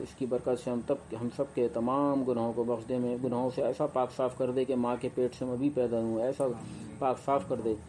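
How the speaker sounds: low, humble supplication; closing prayer. Acted. Closing dua after the sermon. (धीमी आवाज़ में दुआ)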